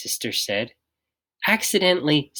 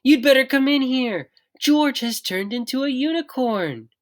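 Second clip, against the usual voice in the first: mother alarmed, calling husband; urgent mom voice; 13 semitones higher than usual. A narrator's reading. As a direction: hurry him in, half panicking. Mom calls Dad urgently.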